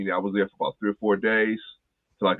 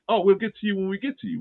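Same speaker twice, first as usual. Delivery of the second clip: higher, breezy brush-off; dismissive staff; 12 semitones higher than usual. Retold or imitated. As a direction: toss it off high and careless. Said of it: "But they're like".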